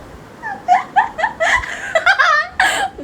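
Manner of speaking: high, loud peals of laughter; gleeful laughter. Real, not acted. Prankster after teasing her sister, high gleeful laughter. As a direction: laugh hard at your own mischief.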